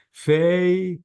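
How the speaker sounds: "Famous" split as fay, mus; two syllables. Shown as a demonstration. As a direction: hold a high fay, then finish "Famous". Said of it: "two syllables".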